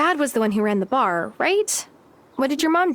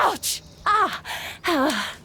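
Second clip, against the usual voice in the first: sharp high cries of pain; pained yelps; 5 semitones higher, much slower than usual. Acted. Natalia smashes her thumb with a hammer, yelping in pain. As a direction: yelp as the hammer hits.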